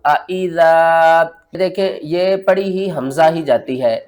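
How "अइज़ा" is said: slow melodic recitation, drawing out अइज़ा; Quran recitation. Shown as a demonstration. Teacher recites अइज़ा with two hamzas. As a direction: recite with both hamzas sounded. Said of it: "तो इसको किस तरह पढ़ेंगे?".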